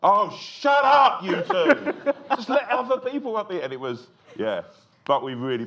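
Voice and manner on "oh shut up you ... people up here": high, loud, slow mocking heckle. Audience heckling the two guests, mock shouting. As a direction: shout them down with a laugh. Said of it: "everyone there was going".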